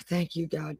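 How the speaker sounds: low, very soft sigh of thanks; hushed thanks. Real, not acted. Preacher pausing to thank God, hushed and low. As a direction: breathe out a quiet, low prayer of thanks.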